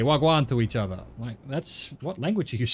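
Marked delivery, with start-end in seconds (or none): 0.0-0.5 quick, louder South London Wagwan; South London greeting. Retold or imitated. Trucker mimicking South London kids greeting each other, louder. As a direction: toss off Wagwan like a London kid. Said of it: "But they just say".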